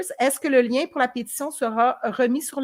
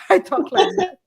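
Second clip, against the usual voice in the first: ordinary delivery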